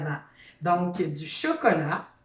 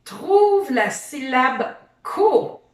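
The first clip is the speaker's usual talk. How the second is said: slow and deliberate, a little louder; slow, clear instruction; 4 semitones higher, 5 dB louder, slower than usual. Shown as a demonstration. Teacher slows down so the child catches the syllable. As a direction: spell out the task slowly. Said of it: "teacher stresses the task".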